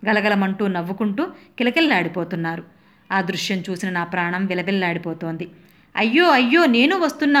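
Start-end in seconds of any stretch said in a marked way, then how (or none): 6.0-7.4 high, loud panicked call; desperate cry. Retold or imitated. Housewife seeing the vans fill, panicked cry. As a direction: wail after the van about to leave you. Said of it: "అనుకుంటూ".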